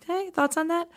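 high, soft, rising ask; gentle inviting uptalk; light upswing. Shown as a demonstration. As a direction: float it up as an open question. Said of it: "It's like".

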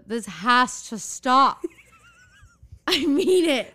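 playful exasperation, rising high; exasperated insistence. Real, not acted. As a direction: insist through a laugh that it stops. Demands co-host stop the accent.

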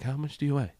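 put-on half-hearted Italian accent; attempted Italian accent. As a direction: imitate an Italian guy, not very well. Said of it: "I don't know how to do an Italian accent".

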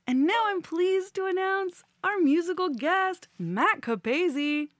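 announcer. Acted. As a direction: present the act like a stage host. Host announces musical guest.

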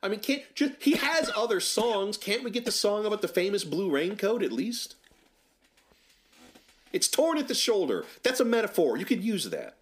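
high-pitched mock-indignant protest; exasperated plea. Real, not acted. Film fan begging directors to pick another [name] song, exasperated. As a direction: plead in a strained high voice, as if fed up.

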